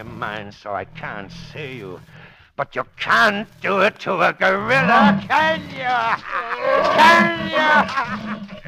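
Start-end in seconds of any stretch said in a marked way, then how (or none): 2.6-8.7 loud, rising mocking challenge; loud taunting. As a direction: throw the question in his face. Villain taunts the Shadow.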